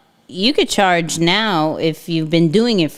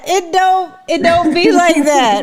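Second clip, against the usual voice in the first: high-pitched, loud protest through giggles; squealed laughing denial; 9 semitones higher, 3 dB louder than usual. Real, not acted. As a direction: insist it isn't so while laughing.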